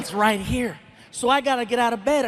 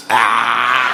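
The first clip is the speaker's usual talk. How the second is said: low, loud scornful grunt; dismissive ah; 11 semitones lower, 5 dB louder than usual. Retold or imitated. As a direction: shoot it down with a grunt. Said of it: "we can kill it with that sound".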